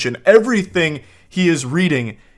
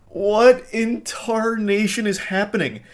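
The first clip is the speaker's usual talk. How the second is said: high, slow disbelieving question; can't believe what she reads; baffled disbelief; 6 semitones higher, slower than usual. Real, not acted. Baffled by the chat.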